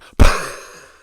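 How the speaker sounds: loud scornful burst of laughter; mocking laugh. Real, not acted. Scoffing at Notre Dame loss.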